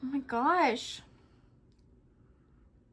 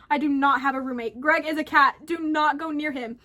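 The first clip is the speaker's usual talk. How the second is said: urgent warning; 3 semitones higher, 5 dB louder than usual. Acted.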